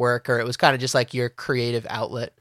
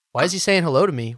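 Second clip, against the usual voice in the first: higher-pitched, hurried wary questioning; mock suspicion; 5 semitones higher, 4 dB louder, faster than usual. Neighbor reacts to a hello, mock suspicion. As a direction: play the affronted stranger, quick and higher.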